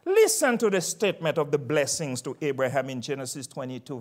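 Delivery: normal talking voice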